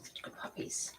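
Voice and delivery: soft breathy whisper. Real, not acted. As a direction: whisper praise to the pups. Whispering to puppies.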